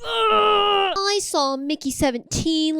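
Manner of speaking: high, drawn-out wail of delight; overcome gushing. Acted. Host announcing the film they saw, overcome gushing. Mock-wailed announcement, then said plainly.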